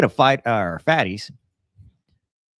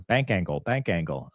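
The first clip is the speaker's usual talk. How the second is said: lightly imitated bank angle warning, a touch lower; cockpit alert; 5 semitones lower, 4 dB quieter than usual. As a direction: lightly mimic an automated cockpit callout, said twice. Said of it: "triggering a bank angle alert".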